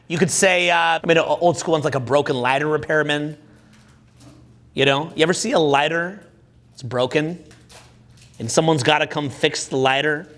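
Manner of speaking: ordinary talk, nothing marked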